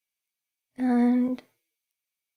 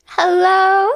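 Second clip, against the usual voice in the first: higher and louder, sung out; excited hello; 6 semitones higher, 6 dB louder than usual. Shown as a demonstration. Streamer greets chat again, louder and excited. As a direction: call the hello out to everyone.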